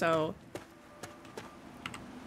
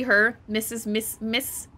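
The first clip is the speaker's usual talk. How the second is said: ordinary delivery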